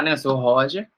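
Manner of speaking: ordinary talk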